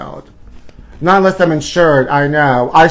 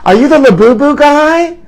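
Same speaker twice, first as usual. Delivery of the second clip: high, louder excited fan question; sweet fan voice; 11 semitones higher, 6 dB louder than usual. Retold or imitated. Fan spotting the vlogger at the Hollywood Bowl, high excited squeal. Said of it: "she's like".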